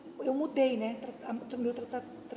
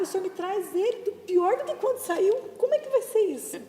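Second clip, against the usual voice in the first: higher, pleading protest to God; exasperated complaint; 6 semitones higher than usual. Retold or imitated. Wife protesting to Jesus about her husband, exasperated and higher. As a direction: voice rising in disbelief.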